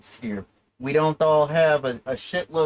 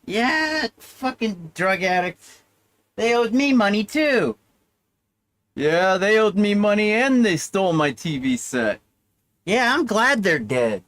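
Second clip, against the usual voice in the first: mourner at funeral; 5 semitones higher than usual. Acted. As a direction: sneer at the dead addict. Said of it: "see how they're talking at your funeral".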